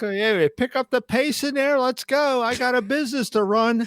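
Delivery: high, pushy hurry-up voice; impatient dad. Retold or imitated. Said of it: "I'm like".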